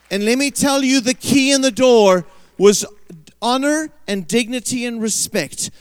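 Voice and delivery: loud, high-pitched preaching; preaching at full force. Real, not acted. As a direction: drive the point home to the congregation, very loud. Preacher drives home the key point.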